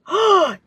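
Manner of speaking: sharp high excited gasp. Real, not acted. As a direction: gasp in delight. Sees the book cover.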